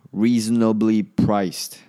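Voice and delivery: slow, loud and carefully spoken "Reasonably priced"; deliberate enunciation. Real, not acted. Podcaster redoing a stumbled phrase, slowly and emphatically.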